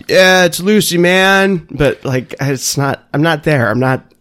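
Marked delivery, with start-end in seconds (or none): 0.1-1.7 high, loud tripping hippie; dreamy acid-head drawl; stoned hippie voice. Retold or imitated. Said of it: "people dropping acid and being like".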